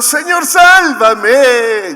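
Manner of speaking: very high, loud and drawn-out plea for rescue; desperate cry. A narrator's reading. Sinking Pedro calling Jesus to save him, desperate slow shout. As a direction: cry out as you sink. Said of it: "Y gritó".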